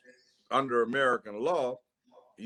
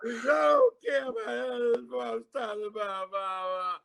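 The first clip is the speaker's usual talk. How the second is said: high, wailing mock sob for mama; mock crying; 12 semitones higher than usual. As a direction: blubber like a grown man crying for his mother. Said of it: "he's crying all over the shit".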